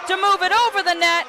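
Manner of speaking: high, driving rally call; excited play-by-play. Real, not acted. Commentator calling a long volleyball rally as it happens, excited. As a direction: follow every dive with rising urgency up to the point.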